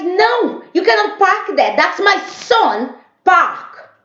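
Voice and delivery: shrill-pitched imitation of an angry neighbor; shouting neighbor.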